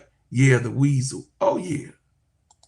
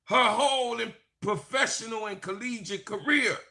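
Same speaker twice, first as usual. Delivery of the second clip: preaching; 9 semitones higher, 3 dB quieter than usual. Real, not acted. Preacher compares Shedeur Sanders to Angel Reese, preaching high. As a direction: build it like a sermon.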